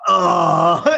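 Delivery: loud frustrated ugh; disgusted groan. Retold or imitated. Annoyed at dinged box corner.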